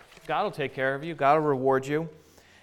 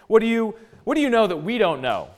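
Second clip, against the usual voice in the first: raised, scornful question; indignant challenge; 6 semitones higher, 4 dB louder, faster than usual. Retold or imitated. Eliphaz asks Job what he knows, indignant challenge. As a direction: throw it at him, pitched up.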